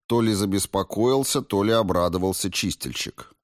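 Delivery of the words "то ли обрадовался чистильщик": usual speaking voice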